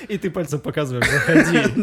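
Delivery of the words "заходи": loud laughing "come in"; laughing invite. Retold or imitated. Mocking gesture invite.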